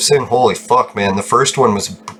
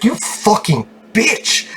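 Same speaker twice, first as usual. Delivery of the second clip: slow, much higher, stretched-out curse; drawn-out insult; 10 semitones higher, much slower than usual. Retold or imitated. Patient to doctor, slow high disbelieving curse. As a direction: savor each word of the insult.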